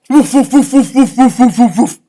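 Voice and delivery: loud, high rapid woofs; big rapid-fire dog woofs; dog barking. Acted. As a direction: bark like an excited dog. Acting the dog barking in story.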